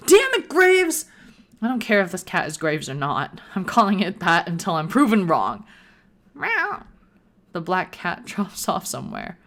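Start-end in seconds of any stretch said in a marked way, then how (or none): 0.1-1.1 high loud slow exasperated yell; hammed-up furious shout; angry yell. Yelling at the cat. 6.4-6.8 high rising smug cat meow; mock smug meow. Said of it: "it sounded kind of smug".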